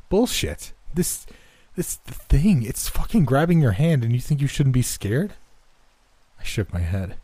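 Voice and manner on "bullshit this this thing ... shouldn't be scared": high, loud, agitated protest; angry disbelief. A narrator's reading. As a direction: burst out, stumbling over the first words in outrage. Shaken, angry outburst at friend.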